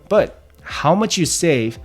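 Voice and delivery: normal talking voice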